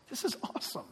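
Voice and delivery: awed whisper. Real, not acted. Pastor whispering awe to the congregation. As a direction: breathe it out in wonder.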